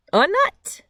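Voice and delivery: jumps way up on nut, amazed; surprised reveal. A narrator's reading. Storyteller to young listeners, amazed reveal. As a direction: reveal the nut with wide-eyed wonder.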